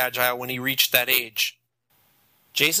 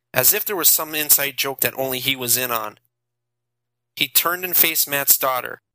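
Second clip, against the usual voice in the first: usual speaking voice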